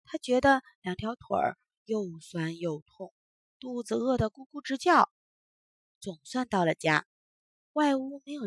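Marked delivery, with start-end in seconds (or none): none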